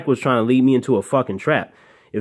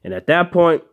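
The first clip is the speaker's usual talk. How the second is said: usual speaking voice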